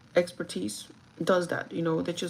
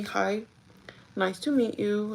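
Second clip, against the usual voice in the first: usual speaking voice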